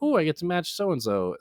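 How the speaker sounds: a hint of a delighted child; mildly gushing little sister; excited little girl. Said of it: "she sounded excited".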